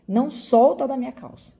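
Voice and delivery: loud mother's command to her son; urgent command. Retold or imitated. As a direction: call it out over the traffic noise. Urgent order to son in traffic.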